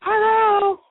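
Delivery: high put-on voice answering the phone; caller's mother. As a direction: pipe up as a pretend mother. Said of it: "Put her on".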